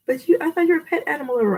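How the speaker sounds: high, quick challenge; mock objecting viewer. Viewer questioning a pet lover, mock objection. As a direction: put on a high, hurried objector's voice.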